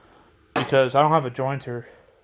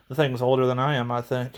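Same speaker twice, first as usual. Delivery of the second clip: ordinary delivery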